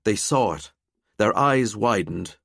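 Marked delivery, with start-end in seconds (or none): none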